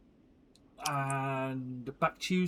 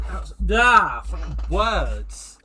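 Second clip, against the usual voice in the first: frustrated outburst; 4 semitones higher, 7 dB louder, slower than usual. Real, not acted. Frustrated at losing her words.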